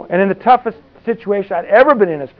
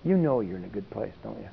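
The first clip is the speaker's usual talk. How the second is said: low, very soft, gentle voice; quiet fatherly tone; 7 semitones lower, 12 dB quieter than usual. Retold or imitated. The Father speaking deep in his spirit, in a quiet low tone. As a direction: say it low and hushed, as a calm father would. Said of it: "right in this tone".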